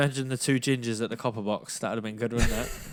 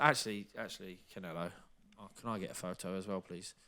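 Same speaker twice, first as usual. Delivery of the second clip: timid ask; 5 semitones lower, 12 dB quieter than usual. Retold or imitated. Timid request retold.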